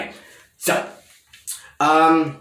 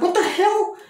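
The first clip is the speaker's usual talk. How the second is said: high, incredulous outcry; mock disbelief; 13 semitones higher than usual. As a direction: sound baffled and outraged. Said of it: "I was like".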